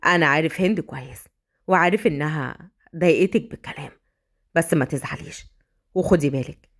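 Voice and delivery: low voice, calming his upset wife; gentle husband. Acted. (صوت زوج هادئ يطمئن زوجته)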